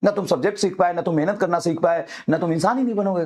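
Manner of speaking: usual speaking voice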